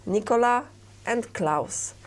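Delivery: normal talking voice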